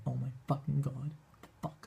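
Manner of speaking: stunned disbelief. Retold or imitated. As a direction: let it sink away in awed shock. Recounting dying after meeting Michael.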